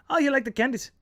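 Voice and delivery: high-pitched imitated southern English accent; thicker southern English accent. Local woman asks the vegetarian, thick southern English accent. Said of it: "You get a thicker accent".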